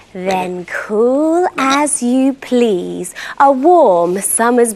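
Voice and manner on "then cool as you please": plain reading voice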